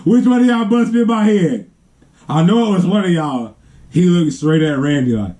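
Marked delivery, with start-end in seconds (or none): 0.0-3.5 higher, accusing uncle questioning; mock angry demand. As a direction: demand to know who hit him. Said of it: "talking about some".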